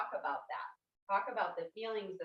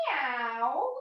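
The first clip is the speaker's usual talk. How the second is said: rising meow, a little louder; cat meow; 3 semitones higher, 5 dB louder than usual. Shown as a demonstration. Yoga teacher shows kids a cat meow. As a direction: demo a cat's meow for the kids. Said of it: "And we're gonna say".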